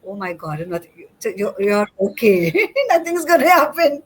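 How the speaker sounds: imitated amused mom, breaking into laughter; retold good-natured laughing dismissal; laughing reassurance. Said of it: "just having a good laugh".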